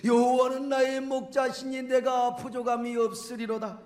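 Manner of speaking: spoken, declaimed psalm verse; spoken scripture recitation. Shown as a demonstration. Preacher quotes the psalm to the congregation, spoken recitation.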